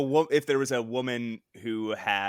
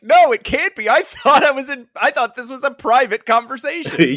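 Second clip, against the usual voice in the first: loud, high-pitched indignant protest; upset mock outcry; 13 semitones higher, 12 dB louder than usual. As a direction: wail it as an overdramatic upset villain. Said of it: "And he's so upset".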